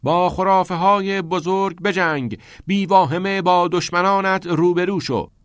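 high, loud declaiming; reading aloud loudly. A narrator's reading. First boy in line reads his slip out before kicking, loudly. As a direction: declaim it boldly from a slip of paper. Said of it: "با صدای بلند از روی تکه کاغذ".

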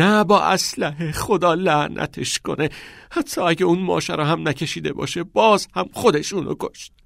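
high, loud grieving shout. A narrator's reading. Grieving boy yelling at his friend about the father, loud. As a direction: hurl the blame through tears. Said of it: "تاد فریاد کشید".

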